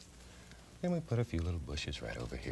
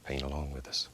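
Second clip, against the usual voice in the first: whispering; 6 dB quieter than usual. Real, not acted. Soft aside to viewers.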